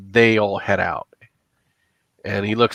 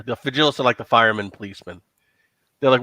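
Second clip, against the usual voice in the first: ordinary talk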